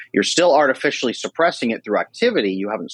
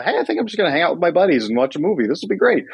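cheerful friend; 6 semitones higher, faster than usual. Retold or imitated.

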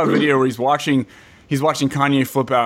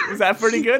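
ordinary talk

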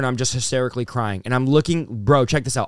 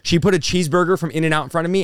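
ordinary talk, nothing marked

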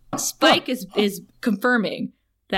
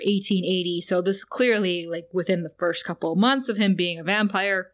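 ordinary delivery

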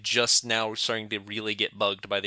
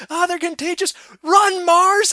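very high, loud cartoon shriek; a planet warning Mars, high panicked shriek; panicked planet cry; 12 dB louder than usual. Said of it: "there's one where they go".

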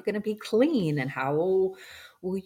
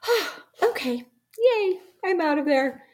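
much higher, giddy cry of relief; relieved soul; 13 semitones higher, 4 dB louder than usual. Retold or imitated. Soul leaving the body, high giddy relief. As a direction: squeal it like a soul just set free. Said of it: "soul part of myself was like".

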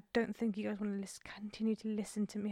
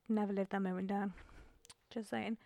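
ordinary delivery

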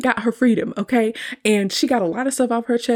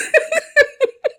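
very high, louder short laugh; real laughter; 5 dB louder than usual. Real, not acted. The curse works, high burst of laughter.

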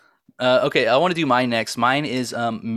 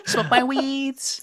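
high mock sing-song; 13 semitones higher, much slower than usual.

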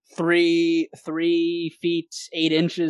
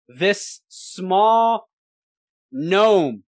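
ordinary delivery

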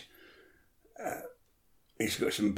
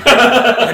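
a loud, high burst of laughter; real laugh; 12 semitones higher, 14 dB louder than usual. Real, not acted. Recalling the chiropodist request, he bursts out laughing. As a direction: cracks up at the memory.

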